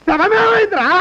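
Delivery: panicked yell on finding the body gone; alarmed shout. Acted. Shocked master finds corpse gone.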